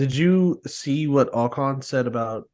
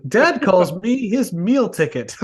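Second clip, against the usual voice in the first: Ralphie voice; 5 semitones higher, 4 dB louder than usual. Retold or imitated. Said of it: "he's like Ralphie from The Simpsons".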